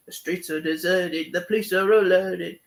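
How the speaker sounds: lightly sung in a hint of Cockney accent; a touch of Cockney sing-along; mock Cockney. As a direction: hum it with a faint Cockney accent. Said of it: "singing in this really heavy Cockney accent".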